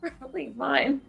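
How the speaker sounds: hiccuping between words; talking through hiccups. Real, not acted. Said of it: "I'm hiccuping here".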